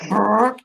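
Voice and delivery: lip-buzzed fart sound; loud imitated fart; fart noise. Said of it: "He was like".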